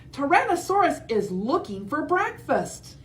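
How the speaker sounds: high, urgent warning; alarmed warning. Acted. Ankylosaurus warning Parasaurolophus, high urgent voice. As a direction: shout the danger.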